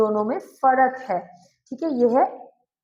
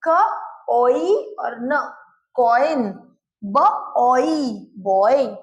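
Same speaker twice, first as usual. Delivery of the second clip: sounded out slowly; much slower than usual. Shown as a demonstration. Teacher breaking coin into sounds for students, slowly. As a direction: slowly split क ओई and न apart. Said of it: "जैसे coin में coin".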